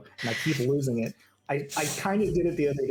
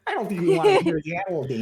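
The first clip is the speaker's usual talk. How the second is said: mock Palin voice; 13 semitones higher, 6 dB louder than usual. Mocks Sarah Palin accent.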